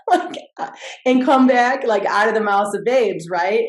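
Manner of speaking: loud, high and laughing through the words. Real, not acted. Mom retelling her son's advice, laughing loudly. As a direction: still laughing as she says it.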